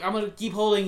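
high, loud acted determination; desperate resolve. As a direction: push it out like holding on in pain. Acted quote, Armin refusing to release.

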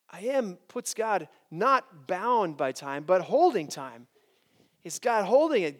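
ordinary delivery

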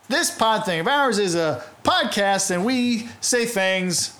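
halting comic cadence with spiky stresses; Norm Macdonald. Acted. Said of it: "you do a good Norm Macdonald".

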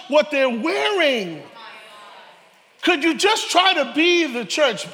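rising to a high, shouted plea; impassioned preaching. Retold or imitated. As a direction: preach it at him, building to a shout. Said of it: "I said to one pastor".

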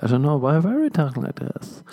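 high, gliding mimicry; silly flirty voice. Comedian echoing the woman's odd voice, flirty. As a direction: answer in the same put-on high coo.

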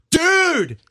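high, loud bro cheer, imitating; mock pumped-up bro shout; excited bro cheer. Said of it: "your first response is".

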